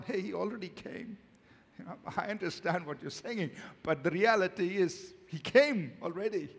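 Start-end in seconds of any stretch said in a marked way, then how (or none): none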